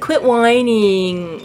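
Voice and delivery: slow scolding. Retold or imitated. Harry telling tired Bob to stop complaining, slow and drawn out. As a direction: drag out Quit whining slowly and evenly.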